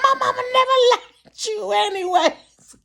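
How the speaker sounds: high, whiny mock-crying retort; tearful jab. Telling crying friend mama disliked him, tearfully. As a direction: wail the insult through mock tears.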